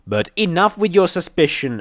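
high, loud outburst; angry yell. A narrator's reading. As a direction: yell it as you round on him. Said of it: "I yelled as I rounded on him".

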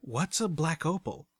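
tentative. A narrator's reading. Narrator asks Watcher about the unfamiliar gem, tentatively. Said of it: "Tentatively, I asked".

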